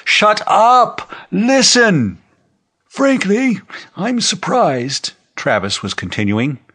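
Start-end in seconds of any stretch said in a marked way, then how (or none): 0.0-2.2 high, loud shout for order; bellowing. A narrator's reading. Man pounding the desk for silence, bellowing. 2.9-5.1 put-on high-pitched old man's voice; old general, firm denial. Old general answering the rumor on screen, high and insistent.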